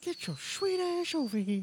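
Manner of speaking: very high, slow old-creep impression; Herbert. Trying the Herbert voice for friends, high and slow. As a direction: draw it out in a high, whistly cartoon voice. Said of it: "he'd do the Herbert voice".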